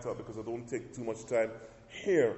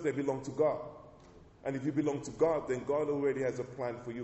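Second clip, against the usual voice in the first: ordinary talk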